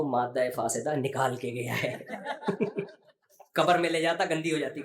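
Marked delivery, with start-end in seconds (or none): 0.0-2.0 laughing punchline. Retold or imitated. Punchline of hakim joke. 2.0-2.8 soft breathy laugh at his own joke. Real, not acted. After telling the hakim joke, a soft airy laugh.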